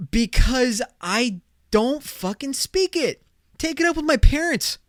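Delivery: high, spaced-out mock exasperation; exasperated retort. Snapping back at people asking about his Spanish, exasperated. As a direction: fire back high, word by word. Acted retort to incredulous questioner.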